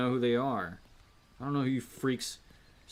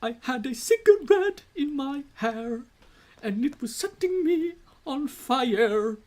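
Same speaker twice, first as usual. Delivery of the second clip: very high, loud put-on singer; 11 dB louder than usual. Mocking the singer [name], high and loud. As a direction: caricature his wild vocals. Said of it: "You can do [name] where he's like".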